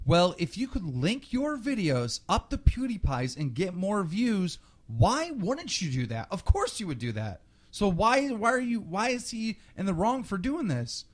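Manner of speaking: high, mock-innocent commenter voice. Host parodying commenters who excuse the cheating, high and mock-innocent.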